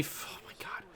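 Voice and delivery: breathed under the breath; quick whispered oh my God; barely voiced whisper; whispered aside. Real, not acted. Aside while doing math.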